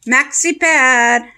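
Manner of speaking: pet talk. Real, not acted. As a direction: coax the little dog sweetly. Cooing to the pet dog.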